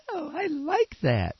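ordinary talk

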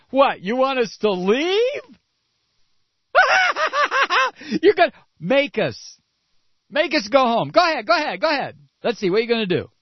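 high, laughing taunt of Russians; mocking Russians. Russians taunting America, mock laughing high taunt. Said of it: "the Russians are sitting there going".

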